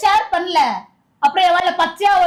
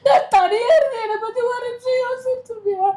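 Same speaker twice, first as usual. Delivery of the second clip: higher, sobbing reproach; 4 semitones higher than usual. Acted. Wife reproaching her husband for leaving her alone, sobbing. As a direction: cry out the hurt of being left alone. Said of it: "சரி, அழுவாதல".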